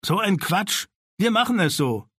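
high, brisk overruling shout; dismissive command. Acted. Sina dismisses the objection and decides for all.

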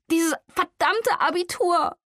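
ordinary delivery

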